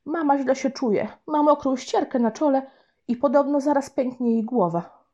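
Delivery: high, put-on worried little boy; boy fibbing. Boy tells grandma on the phone that mum is ill, fibbing in a high worried voice. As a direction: sell the lie with a sad tone. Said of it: "Nareszcie będę mógł kogoś nabrać".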